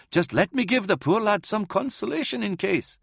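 high, lightly mumbled plea; mumbling old priest. A narrator's reading. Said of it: "mumbled Zwiel".

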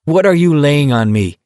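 mildly furious comeback. A narrator's reading. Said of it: "Collie Parker said furiously".

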